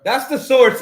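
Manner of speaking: loud, high-pitched insistence; emphatic correction. Real, not acted. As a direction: call out the answer forcefully. Answers the news clip emphatically.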